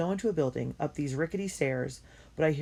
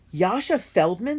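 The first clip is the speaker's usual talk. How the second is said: high, loud, slow disbelieving repeat of the name; a group of men hearing a famous name, astonished; amazed exclaiming; 6 semitones higher, 9 dB louder, slower than usual. Acted. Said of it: "several men exclaim".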